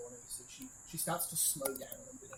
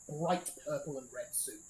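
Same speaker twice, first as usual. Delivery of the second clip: ordinary talk, nothing marked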